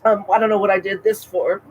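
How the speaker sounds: ordinary talk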